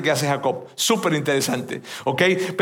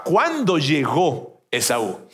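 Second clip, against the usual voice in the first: usual speaking voice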